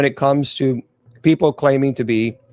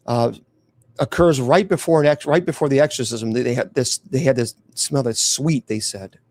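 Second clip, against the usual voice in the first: ordinary delivery, nothing marked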